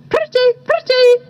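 high parrot chirp; twenty-eight parrot. A narrator's reading. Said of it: "said the twenty-eights".

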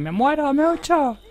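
very high, loud mock wail; hammed-up dying cry, last word held long; translated dying cry. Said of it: "Acaplova quiere decir". (grito agudo de agonía fingida)

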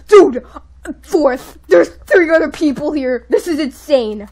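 loud, stretched-out disbelief; amazed outburst. Real, not acted. Player seeing only three others left, amazed outburst. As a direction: exclaim it in stunned excitement.

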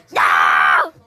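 loud cry, then a loud warning; frustrated shout. Real, not acted. Child in a game shouting loudly as trouble approaches. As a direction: shout it out in dismay.

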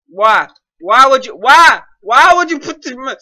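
loud, high exasperated questioning; exasperated rant. Real, not acted. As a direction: demand it loudly, hitting each why. Ranting at the map creator.

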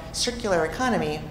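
normal talking voice

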